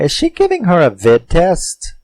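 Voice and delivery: high, baffled "vid test?"; incredulous question. Retold or imitated. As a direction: pitch jumps way up in disbelief. Incredulous thought at odd scene.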